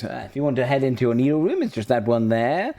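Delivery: sweet hostess showing the rooms; lightly voiced Betsy, fussing over guests; shopkeeper voice. Betsy pointing guests to their rooms, sweetly.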